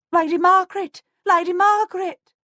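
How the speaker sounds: high, loud frantic calls across the orchard; panicked calling. A narrator's reading. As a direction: shout for her mistress in alarm. Servant woman calling out in panic.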